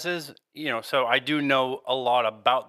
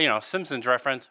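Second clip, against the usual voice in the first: normal talking voice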